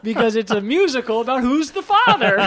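loud, high, laughing delivery. Real, not acted. Host explaining a bad film pitch, laughing. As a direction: pitch it while cracking up.

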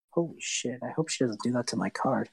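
low, hushed worried aside; Invo. Acted. Invo fears for the card, low hushed muttering. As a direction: mutter it low and soft, half to yourself. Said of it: "hear Invo's voice go".

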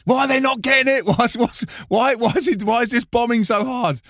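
high, strained, stumbling over repeated words; inner mock panic. Performer silently losing it as the show bombs, mock panic. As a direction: play losing your mind on the inside. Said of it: "everything inside is like a bulldog chewing a wasp".

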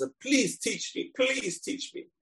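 high beseeching please teach me; pleading beg. Retold or imitated. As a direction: plead like a desperate student. Man begging Shlomo to teach him.

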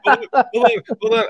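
laughing. Real, not acted. Laughing while interrupting.

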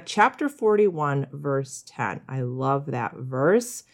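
ordinary talk, nothing marked

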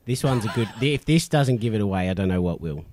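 ordinary talk, nothing marked

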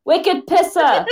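high, drawn-out Boston slang; exaggerated mock Boston accent. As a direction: ham up a Boston accent, slowly. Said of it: "I don't got that accent".

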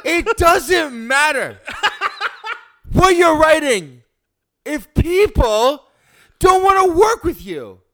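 milk every word like a stage actor; hammy stage actor. Pretend. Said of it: "Say the first line".

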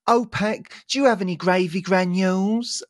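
put-on high-pitched kid calling on a grown-up; mimicked small lad, asking up high; imitating little boy. Boy asks the German man, high little-boy voice. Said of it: "Like that".

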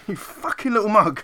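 high, loud mock telling-off; mock scolding mum. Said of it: "She's probably like".

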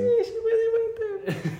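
high squealing laugh through the words. Real, not acted. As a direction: laugh it out in disbelief. Laughing reaction to a friend.

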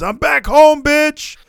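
high, loud wrestler's crowd call; triumphant shout. Retold or imitated. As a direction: shout it out to the whole arena. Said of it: "looks at the crowd and says".

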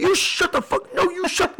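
high-pitched mock yell; imitated yelling. Companion yelling at the gate agent, mock furious. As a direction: mimic a furious companion cutting in, voice way up. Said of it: "like, yelling at this chick right now".

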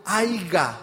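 "Aiga" with a g in place of haya; imitated mispronunciation. Speaker quoting a lecturer's slip, "Aiga" said with a g. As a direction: quote the slip "Aiga", g clearly sounded. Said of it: "el tipo dijo".

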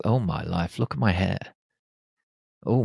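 usual speaking voice

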